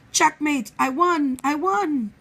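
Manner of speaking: loud gloating cheer; gleeful triumph. Acted. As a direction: crow over the win. Wolf triumphs at chess.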